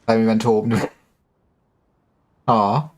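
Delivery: normal talking voice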